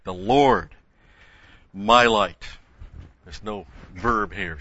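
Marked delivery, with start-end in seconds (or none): 0.0-2.4 forceful stress on Lord and light; "Lord" and "light" hit hard, with a pause between; emphatic. Shown as a demonstration. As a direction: stress each of "The Lord" and "my light" as its own strong statement. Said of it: "very emphatic".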